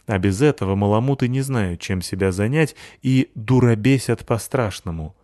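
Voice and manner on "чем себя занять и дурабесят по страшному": ordinary delivery, nothing marked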